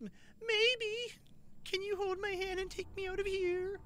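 high, small put-on child voice; lost little child. Gamer playing a lost child asking for help, high meek voice. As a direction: plead meekly in a high little voice.

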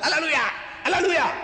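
high, loud burst of Alleluia; high-pitched exclaimed cry; ecstatic shout. Retold or imitated. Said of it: "they shout".

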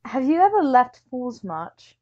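ordinary delivery

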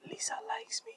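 high, hushed whisper of surprise; stunned secret whisper; whispered disbelief. Acted. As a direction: whisper it, half thrilled.

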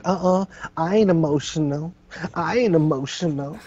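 high, crooning denial like [name]; Cat Williams impression. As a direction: croon it high and insist you're fine. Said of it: "he sounds like [name], crooning".

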